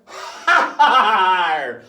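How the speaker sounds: big, slow, loud hearty pirate laugh. Shown as a demonstration. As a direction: throw your head back and laugh like a pirate. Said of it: "stand and laugh like a pirate".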